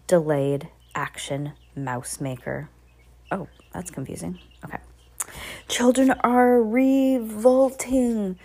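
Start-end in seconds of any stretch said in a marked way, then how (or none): 5.7-8.4 slow, higher, louder witch cry; drawn-out outburst from a ranting witch; screamed declaration. A narrator's reading. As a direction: scream it out slowly as the Grand High Witch. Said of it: "screamed the Grand High Witch".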